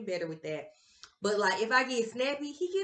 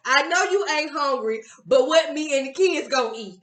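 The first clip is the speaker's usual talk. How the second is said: mimicking partner; 4 semitones higher, 7 dB louder than usual. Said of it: "You said".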